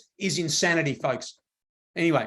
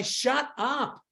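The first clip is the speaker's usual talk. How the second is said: high-pitched mock yell without the volume; half-voiced call for attention; mild mock yell; 4 semitones higher, much slower than usual. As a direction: imitate yelling at everybody, held back. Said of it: "I'm trying to yell at everybody".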